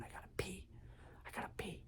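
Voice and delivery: urgent whisper. Retold or imitated. Girlfriend in the closet, urgently whispering she must pee. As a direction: hiss it so the cops don't hear. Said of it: "She kept going".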